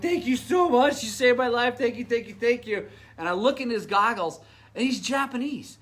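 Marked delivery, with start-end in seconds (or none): 0.0-2.9 retold gushing thanks, higher and louder; effusive thanks. Racer hugging the rider who saved his life, effusively grateful. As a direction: pour out thanks in a raised, urgent voice. Grabs rescuer, thanking him emotionally.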